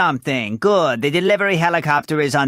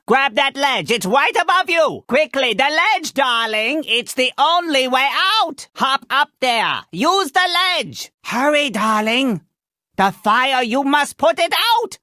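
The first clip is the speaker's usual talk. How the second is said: put-on high-pitched, pressing orders in a theatrical accent; urgent commands; 6 semitones higher than usual. Mentor urging hero up the ledge from fire, urgent high cries. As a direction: urge them on before it is too late.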